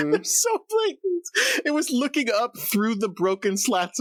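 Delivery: high, drawn-out squeal of disbelief through laughs; helpless laughter. Real, not acted. Co-host describing the shot, slow, high, laughing voice. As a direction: laugh so hard the words come out squeaked and slow. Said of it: "I'm sorry, I'm laughing".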